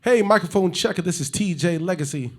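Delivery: loud, high announcer hype; hype intro. Acted. Host hyping the podcast intro, loud and high. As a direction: shout the intro like a radio host.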